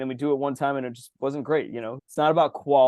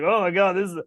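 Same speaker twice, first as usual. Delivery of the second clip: higher, loud mock drunk delight; hammed-up tipsy foodie, voice pitched up; tipsy judge; 7 semitones higher, 3 dB louder than usual. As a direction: play the drunk judge savouring sugar. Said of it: "I'm just like".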